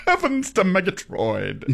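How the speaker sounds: high, slow, lightly voiced cartoon catchphrase; cartoon character voice. Co-host imitating a sixties cartoon character, in a lightly voiced cartoon voice. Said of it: "And he would talk like this".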